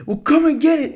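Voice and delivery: hammed-up defiant taunt; Finn challenge. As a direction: toss out the challenge like the hero. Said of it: "Finn just goes".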